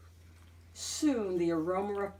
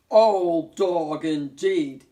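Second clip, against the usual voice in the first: muttered; 10 dB louder, much slower than usual. A narrator's reading. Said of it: "he muttered".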